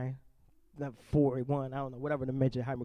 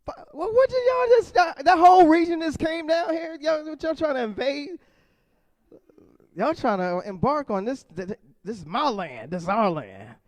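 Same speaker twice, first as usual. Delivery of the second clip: much higher, louder hammed-up indignation; mock outrage; 11 semitones higher, 8 dB louder than usual. Comic to the crowd, loud hammed-up outrage. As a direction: defend his turf from the invaders.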